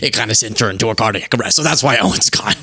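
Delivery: mock over-explaining, low and hushed; hyper-specific excuse. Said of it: "He just gets hyper-specific".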